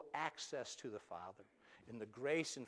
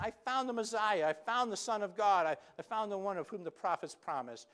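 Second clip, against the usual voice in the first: much higher and louder, bursting with news; excited announcement; 9 semitones higher, 12 dB louder than usual. Retold or imitated. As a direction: shout the good news. Philip announcing the Messiah.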